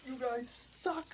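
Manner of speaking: high, soft imitation jeer; toned-down pretend tirade; mock rant. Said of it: "he really laid into him".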